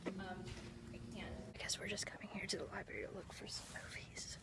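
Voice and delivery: hushed whisper so nobody is disturbed; library whisper. Real, not acted. Vlogging inside the library to viewers, whispering. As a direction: keep it breathy and quiet like among the shelves.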